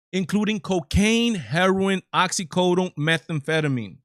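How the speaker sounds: each drug name punched loud and high, "cocaine, heroin, oxycodone, methamphetamine"; incredulous emphasis. Real, not acted. Host reads out Oregon's decriminalized drugs, incredulous, stressing "cocaine" and "heroin".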